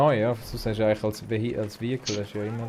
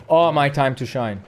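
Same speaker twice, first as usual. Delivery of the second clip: excited; 4 semitones higher, 8 dB louder than usual. Real, not acted. Tank appears, gamer boasts.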